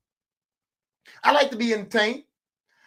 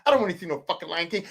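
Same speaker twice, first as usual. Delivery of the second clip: ordinary talk, nothing marked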